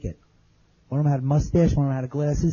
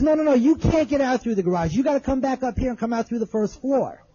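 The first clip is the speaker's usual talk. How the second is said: urgent retold calling; 9 semitones higher than usual. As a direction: imitate urgently calling someone back. Retold yelled exchange, urgent warning.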